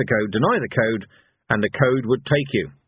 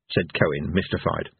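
usual speaking voice

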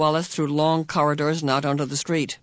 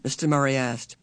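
ordinary delivery

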